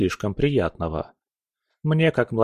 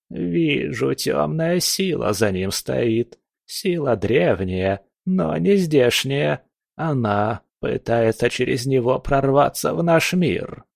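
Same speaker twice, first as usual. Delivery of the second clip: put-on slow, ominous old fortune teller; a lightly voiced old witch; old crone voice; 2 semitones higher, slower than usual.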